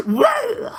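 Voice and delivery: high mock whoa, as after smelling salts; jolted awake. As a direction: burst out as if jolted by a sniff. Acts out reaction to sniffing cumin.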